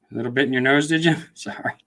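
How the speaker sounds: normal talking voice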